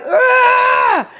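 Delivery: high, loud straining scream; a piercing birthing yell; labor scream. Retold or imitated. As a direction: scream with the push. Pushing out the baby.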